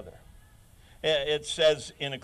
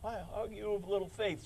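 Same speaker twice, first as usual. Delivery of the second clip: soft reproach; 4 semitones higher, 7 dB quieter than usual. Retold or imitated. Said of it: "Jesus said".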